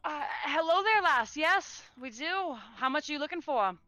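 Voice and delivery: put-on old shopkeeper lady. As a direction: greet the customer as an old shopkeeper. GM voices the shopkeeper.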